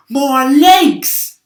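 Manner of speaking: high, loud, furious wail; angry centipede scream. A narrator's reading. Centipede cries out at the paint on his legs, high loud angry wail. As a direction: shriek it out as an outraged bug. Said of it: "screamed with anger".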